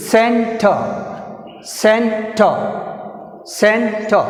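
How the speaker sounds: "Center" with a held CEN, then a drop; teacher modelling "Center", stressed at the start; first-syllable stress. Shown as a demonstration. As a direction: push CEN, let "Center" fall. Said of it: "first syllable".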